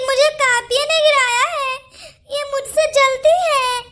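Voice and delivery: high, whiny crying complaint; tearful tattling. Acted. Crying to mother-in-law, blaming.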